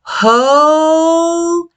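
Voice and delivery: "Jose" held with a breathy h; drawn out Jose. Shown as a demonstration. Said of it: "Let's try it again".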